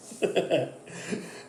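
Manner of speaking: short laugh at himself; amused laugh. Real, not acted. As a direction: laugh off your own silly voice. Laughs at own put-on voice.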